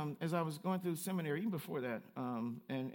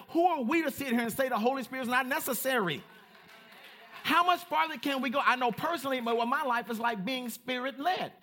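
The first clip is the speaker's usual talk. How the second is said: higher, louder preaching with mounting fervor; rising sermon voice, fervent and insistent; full-force preaching; 7 semitones higher, 4 dB louder than usual. Acted. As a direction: preach it out to the congregation.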